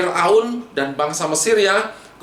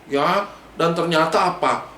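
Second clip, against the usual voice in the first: usual speaking voice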